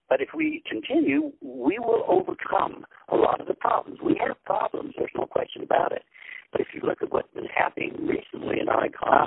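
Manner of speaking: choppy cutting-out phone call; breaking up phone line. Real, not acted. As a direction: voice dropping out over a bad line. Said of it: "when you started to say, "If we continue," you started again to, to break".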